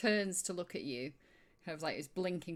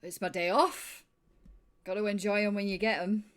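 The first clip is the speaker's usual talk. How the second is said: tipsy cheer; 3 semitones higher, 8 dB louder than usual. Acted. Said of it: "and he's like".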